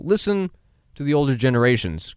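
normal talking voice

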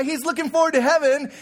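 ordinary talk, nothing marked

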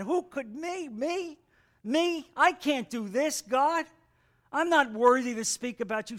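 high, incredulous questioning; acted disbelief. Acts out his unworthiness to God.

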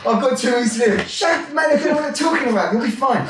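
high-pitched, hammed-up shouted brush-off; breezy mock dismissal. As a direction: wave away a mate's worries, half laughing. Acted English brush-off of bad news.

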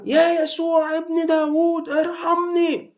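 high, slow, drawn-out pleading cry; desperate high plea, stretched out; crying out for mercy. Acted. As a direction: wail it like a blind beggar begging to be heard. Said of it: "وهو يعلي صوته أكتر".

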